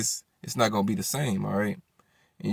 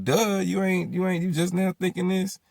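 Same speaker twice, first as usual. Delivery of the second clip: higher-pitched imitation of her comeback; mock scornful retort; 8 semitones higher than usual. Baby mama answering his pregnancy question, mock scornful. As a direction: toss it back like it's obvious.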